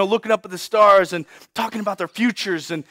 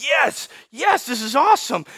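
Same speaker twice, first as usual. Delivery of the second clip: raised pitch, eager cheering; excited boy Ramses; 5 semitones higher than usual. Acted. Young Ramses hears he will rule, excitedly. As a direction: lap up grandfather's praise eagerly. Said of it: "Ramses was like".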